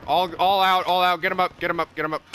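loud urgent callouts; rally the squad, loud; excited shouting. Real, not acted. Urging teammates in a firefight.

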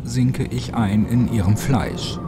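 plain reading voice

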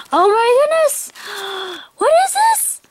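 excited squeal climbing very high; really high voice. Real, not acted. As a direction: squeak in giddy disbelief. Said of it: "My voice is getting really high".

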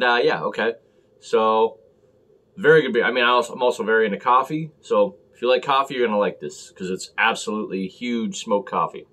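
mug rim at lips; muffled by glass mug; talking into a mug. Real, not acted. Seen 1: Mug held at lips, partly covering mouth while he speaks.

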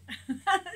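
bright little laugh; short laugh. Real, not acted. Coach laughing at a viewer's question.

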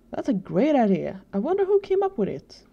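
loud start, leaping high at the end; pleased with the idea, pitch jumping up; enthusiastic approval. A narrator's reading. Tucker grinning at his friend's plan, enthusiastic.

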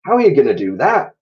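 high, loud sharp question; Mrs Twit. A narrator's reading. Mrs Twit questioning Mr Twit outside the house. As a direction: challenge him loudly.

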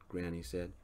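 ordinary delivery

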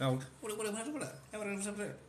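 playful imitation of a comedian's catchphrase; Vince Vaughn impression. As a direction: bounce the greeting like a goofy movie guy. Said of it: "That dude was like".

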